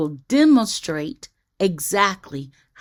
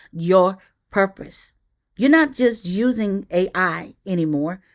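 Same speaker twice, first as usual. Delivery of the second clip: ordinary talk, nothing marked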